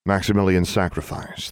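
ordinary delivery, nothing marked

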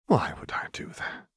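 breathy whisper; breathed. Acted. Renata breathes a whisper to Seraphine. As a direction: whisper it like a private threat. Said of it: "Renata breathed".

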